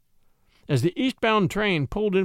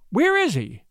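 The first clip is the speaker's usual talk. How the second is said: high-pitched, anxious question; alarmed cry; 7 semitones higher, 3 dB louder than usual. A narrator's reading. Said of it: "she cried in alarm".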